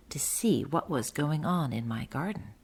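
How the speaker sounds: soft secret whisper. Acted. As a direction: whisper while peeking out. Mole peeks out secretly.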